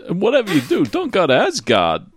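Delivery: high start that falls away; Christopher Walken. Acted. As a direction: warn a son as the celebrity playing Kratos. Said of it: "Christopher Walken doing his version of, uh, Kratos".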